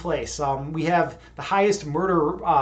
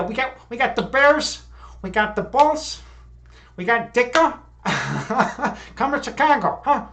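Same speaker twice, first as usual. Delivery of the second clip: hammed-up Chicago accent, higher and louder; tough Chicago talk; 7 semitones higher, 5 dB louder, slower than usual. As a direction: brag like a tough Chicago local, loud and high. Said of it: "That's how we talk Chicago style".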